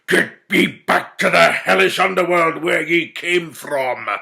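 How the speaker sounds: loud, menacing command; angry order. A narrator's reading. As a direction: banish them with contempt. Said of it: "ordered Mowbray".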